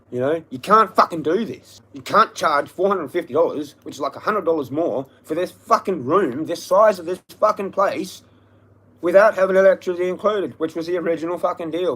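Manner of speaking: loud, high-pitched indignant tirade; angry rant. Real, not acted. Ranting about rent and electricity.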